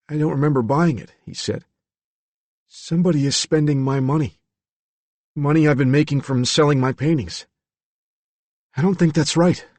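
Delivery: lightly voiced troubled young man; confused young man. A narrator's reading. Billy voiced higher throughout.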